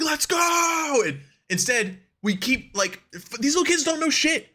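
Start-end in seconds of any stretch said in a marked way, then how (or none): 0.0-1.0 much higher mock hype yell; hype shout. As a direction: yell it like a fan freaking out. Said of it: "he'd be like".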